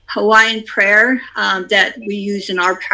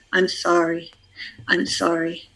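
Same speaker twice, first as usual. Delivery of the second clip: quiet repeated apology; hushed inward sorry; soft prayer; 3 semitones lower, 7 dB quieter than usual. Shown as a demonstration. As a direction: say it softly to yourself. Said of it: "you just keep saying that".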